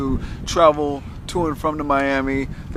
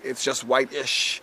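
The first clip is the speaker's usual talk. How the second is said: slow punchline; 3 semitones lower, 6 dB quieter, much slower than usual. Real, not acted. Punchline delivered slowly.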